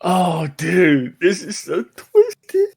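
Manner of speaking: giddy delight, pitch climbing high; real giddy disbelief, slowing down. Real, not acted. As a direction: stretch it out, about to crack up. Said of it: "I'm so giddy right now".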